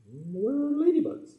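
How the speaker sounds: high, slow prim ladybug voice. Acted. Ladybug puppet tells the children proudly, high and slow. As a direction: say it with dainty pride.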